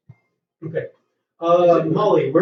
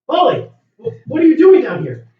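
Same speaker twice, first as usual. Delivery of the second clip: surprised; 7 semitones higher, 7 dB louder than usual. Acted. GM voices Bob surprised.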